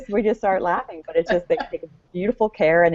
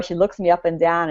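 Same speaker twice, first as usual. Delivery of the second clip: usual speaking voice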